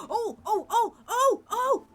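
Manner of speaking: high, loud excited oh's; rising excited exclamation; excited gasp. Real, not acted. Sudden discovery in game.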